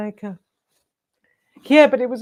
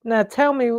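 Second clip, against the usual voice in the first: ordinary talk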